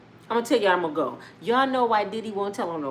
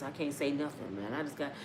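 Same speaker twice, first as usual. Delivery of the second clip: "I can't say nothing" almost breathed, much softer; hushed despair; 8 semitones lower, 14 dB quieter than usual. Retold or imitated. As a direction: let "I can't say nothing" die in your throat. [name] voiced, despairing about kids.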